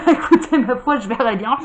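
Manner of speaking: chuckling through the words, lips breaking into laughs; laughing. Real, not acted. Amused host laughing at his own slip of the tongue to viewers, chuckling.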